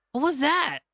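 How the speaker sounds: high, loud stunned question; shocked TJ voice. Acted. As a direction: blurt it out in disbelief. TJ shocked after the kiss.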